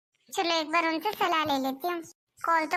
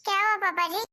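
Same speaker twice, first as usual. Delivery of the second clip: usual speaking voice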